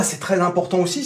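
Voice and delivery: normal talking voice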